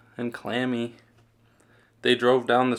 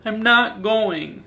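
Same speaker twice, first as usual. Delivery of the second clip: higher, slow, sulky refusal; stubborn child; 10 semitones higher, 4 dB louder, slower than usual. A narrator's reading. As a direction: dig in your heels, drawn out. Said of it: "said Sarah".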